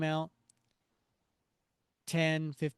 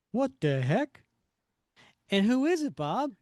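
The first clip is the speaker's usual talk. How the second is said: raised, louder comic-book exclamation; acted startled demand; 8 semitones higher, 6 dB louder than usual. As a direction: blurt it out at a sudden interruption. Acting startled comic dialogue.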